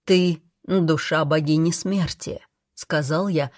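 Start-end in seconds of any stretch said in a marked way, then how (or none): none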